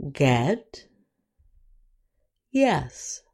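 "eh" alone, then Bed, dead, get, yes with that vowel; short E. Shown as a demonstration. Teacher modelling the short E sound, careful pronunciation. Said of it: "the short E sound is".

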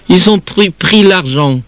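put-on high tearful child, words catching and repeating; high-pitched stammering lament; sobbing, broken voice. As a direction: wail it through acted sobs. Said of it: "d'une voix entrecoupée de sanglots".

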